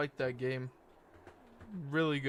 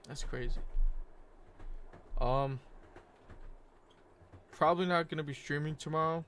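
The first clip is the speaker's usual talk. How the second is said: ordinary delivery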